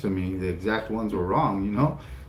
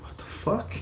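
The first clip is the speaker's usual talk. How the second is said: high whispered disbelief; stunned whisper; 12 semitones higher, 7 dB quieter than usual. Retold or imitated. Boy hearing he must retake the test, a stunned whisper. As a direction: gasp it under your breath in shock.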